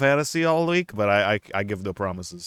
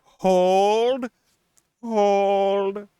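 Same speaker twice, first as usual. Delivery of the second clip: high hammed-up call; 8 semitones higher than usual. Echoing the Azalea Hold sound.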